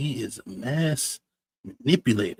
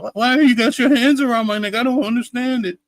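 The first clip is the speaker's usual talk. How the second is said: mock innocent victim; 11 semitones higher, 6 dB louder than usual. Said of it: "You know what I'm saying?".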